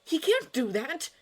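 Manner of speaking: high-pitched indignant protest; indignant, voice jumping up; outraged exclamation. A narrator's reading. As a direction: burst out in disbelief and outrage. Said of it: "exclaimed Arthur, looking outraged".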